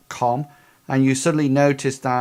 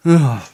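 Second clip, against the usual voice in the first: short loud grunt of pain; 3 semitones higher, 10 dB louder than usual. Acted. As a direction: grunt as if hit. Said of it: "he saw like goes".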